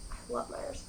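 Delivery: soft whispered aside; whispering. Real, not acted. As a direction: whisper it to yourself. Aside, unvoiced.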